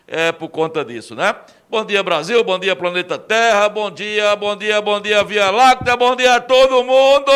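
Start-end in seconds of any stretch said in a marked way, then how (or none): 1.7-7.3 booming high announcer greeting; radio announcer. Acted. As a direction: call it out to the whole town.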